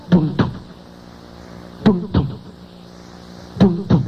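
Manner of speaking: low, short double thump; imitated heartbeat. Doctor hears a beat behind the door, imitated heartbeat. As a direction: mimic a heartbeat in evenly spaced low thuds. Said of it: "escucha un".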